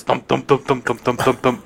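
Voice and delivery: steady, even run of thumps; imitated thumping. Cabin mate heard at night, steady imitated thumping. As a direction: chant the word as a repetitive knocking noise. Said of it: "all of a sudden hear this".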